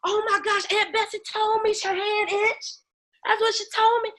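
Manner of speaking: hammed-up thrill over the omen; mock excitement. Retelling Aunt Bessie's prophecy, giddy mock excitement. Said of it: "You be like".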